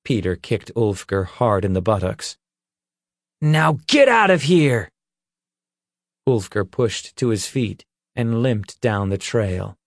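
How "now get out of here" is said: loud, high shout to drive him off. Acted. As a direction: yell him out of the woods. Peter kicks out the intruder.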